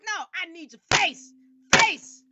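loud, high, insistent yell for faces; aggressive shout. Real, not acted. Host yelling at audio-only contestants, loud and high. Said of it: "I'm feeling a little aggressive tonight".

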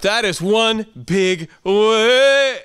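high, slow, dragged-out alarm at a wave; Peter's alarm. Retold or imitated. Preacher voicing Peter in the storm, drawn-out alarm. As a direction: play Peter gaping at a huge wave. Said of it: "Then he said".

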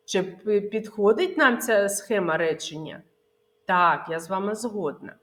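normal talking voice

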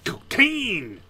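loud, high gleeful shout; excited shout. Real, not acted. Streamer at the cocaine lockup, loud gleeful shout. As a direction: cheer it like you hit the jackpot.

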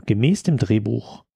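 ordinary talk

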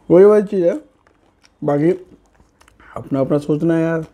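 talking while chewing; speaking between bites; mouth full. Real, not acted. Seen 1: Bite taken at -1.0; mouth moves with food while talking.

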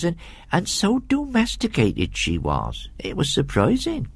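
rustic servant woman, gossiping; comfortable housekeeper's dialect voice; chatty country housekeeper. Acted. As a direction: lilt through it like a homely storyteller.

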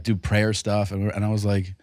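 ordinary delivery

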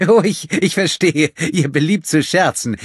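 high, loud, stammering nervous chuckle voice; nervous, startled. A narrator's reading. Startled innkeeper to menacing guests, high and nervous. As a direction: pitch it right up, laughing off your fright. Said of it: "irritiert und auch ein bisschen erschrocken".

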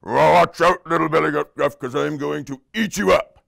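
threatening troll. Acted. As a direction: menace a small goat as a troll. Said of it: "And the troll said".

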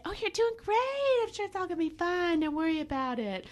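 high singsong mock reassurance; mock soothing flatterer. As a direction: coo empty comfort like a flatterer. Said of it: "somebody never tell you and just say".

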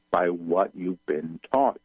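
normal talking voice

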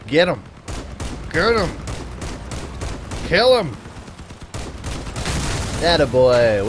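loud, rising cheer; cheering shouts. Real, not acted. Gamer cheering his drone buddy on, loud. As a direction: egg the drone on to kill.

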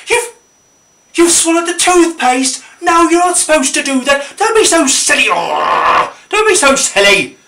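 high, loud, exasperated telling-off; hammed-up outraged scolding, voice shooting up; mock scolding. Scolding a child who swallowed the toothpaste, exasperated.

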